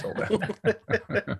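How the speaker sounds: giggling through the punchline; laughing. Real, not acted. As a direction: laugh the words out, high. Laughing at the sold-out book.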